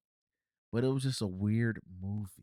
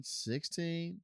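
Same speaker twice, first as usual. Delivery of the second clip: normal talking voice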